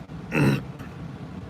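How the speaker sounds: soft, rough throat clear; quiet throat clear on a tired voice. Real, not acted. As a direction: clear the throat to get the voice back. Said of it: "I think I'm losing my voice".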